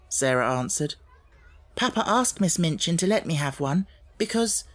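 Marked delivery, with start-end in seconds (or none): 1.7-4.6 put-on higher, lively girl explaining a secret; eager young girl. A narrator's reading. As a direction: tell it like a shy confession of a game. Sarah voiced in the story.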